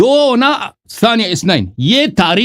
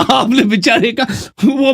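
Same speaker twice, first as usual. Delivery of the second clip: laughing; 3 semitones higher than usual. Real, not acted. Laughing while mocking opponent.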